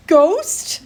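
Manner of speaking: high, loud panicked cry; terrified yell. A narrator's reading. The boy hears the wailing and yells out in terror, panicked. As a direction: yell it in sheer fright. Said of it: "the second voice yelled".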